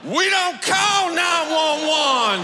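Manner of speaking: high, slow, shouted, laughing; slow shouted punchline. Acted. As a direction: drag it out and yell it at Daryl. Wife delivering the punchline.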